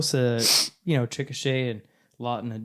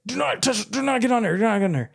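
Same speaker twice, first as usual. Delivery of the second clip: much higher, louder frantic command; urgent dad warning; 9 semitones higher, 4 dB louder, faster than usual. Retold or imitated. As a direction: yell the warning to stop the kids. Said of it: "I was like".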